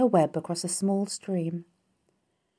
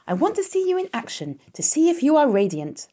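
high, raised-voice command; 10 semitones higher, 4 dB louder than usual. A narrator's reading. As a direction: urge the performer on, pitched well up. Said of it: "commanded Charlotte".

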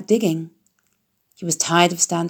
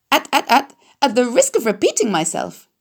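stammering goose; 6 semitones higher than usual. A narrator's reading. Goose repeating herself to the confused pig, honking stutter. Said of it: "who talked rather fast".

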